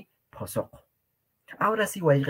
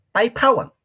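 Pai phawan, higher and louder, phawan stressed; emphatic model; 10 semitones higher, 6 dB louder than usual. Shown as a demonstration. Teacher stressing the conjugated form for students. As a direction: hit phawan with a strong aspirated ph. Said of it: "Estoy diciendo él o ella corre".

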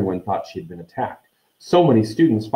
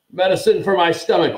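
higher-pitched urgent grunt; 10 semitones higher, 4 dB louder than usual. A narrator's reading. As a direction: grunt it out in a raised voice. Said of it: "Ron grunted".

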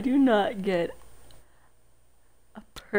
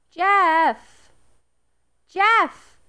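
loud call to the fish; 7 semitones higher, 10 dB louder than usual. Real, not acted. Owner shouting her fish's name. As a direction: shout his name across the room.

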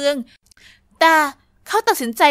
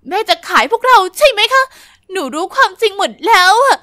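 accusing yell; 5 semitones higher, 6 dB louder than usual. Acted. Girl accuses mother.

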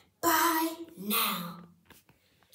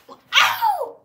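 yelp of pain; 13 dB louder than usual. Acted. Toy cries out.

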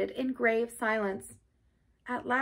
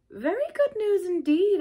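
high-pitched, doubtful echo; dubious; 10 semitones higher than usual. A narrator's reading. Rat doubting Toad's repentance, dubious. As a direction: agree while clearly not believing it. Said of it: "observed the rat dubiously".

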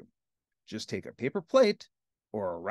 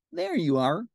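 pitched-up cartoon pal; Ernie; 4 semitones higher than usual. Ernie presents the last item, high cartoon voice. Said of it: "said Ernie".

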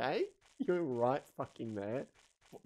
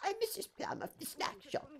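high, quiet shopkeeper voice; old lady voice; 8 dB quieter than usual. Acted. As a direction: squeak it like a little old shopkeeper. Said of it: "I should do a voice".

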